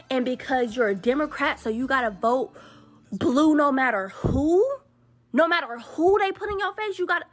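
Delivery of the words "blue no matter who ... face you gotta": mocking slogan.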